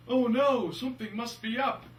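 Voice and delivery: high, loud start of hammed-up worry; mock alarm. Commander hearing of a lost plane, mock alarm.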